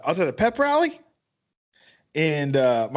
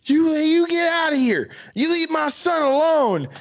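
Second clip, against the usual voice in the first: a dad shouting at the cops; dad chasing cops from the door, mock yell; retold yelling; 10 semitones higher than usual. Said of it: "I hear my dad yelling".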